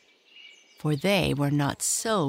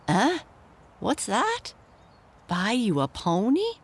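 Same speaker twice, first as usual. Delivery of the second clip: higher, playfully mock-surprised father; half teasing; 6 semitones higher than usual. Father teasing his son about wanting a pony, playful mock surprise. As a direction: tease the boy, pretending to be shocked. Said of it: "half teasing".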